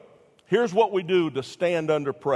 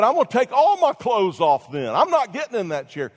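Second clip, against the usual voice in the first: put-on defiant boy; 7 semitones higher, 5 dB louder than usual. Defiant boy refuses dentist.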